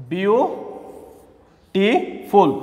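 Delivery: "Beautiful" drawn far apart in three beats; long pauses between syllables of "beautiful"; split into syllables. Shown as a demonstration. Teacher recapping the three parts, "beautiful" very slowly syllable by syllable. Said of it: "এখানে হচ্ছে তিনটা".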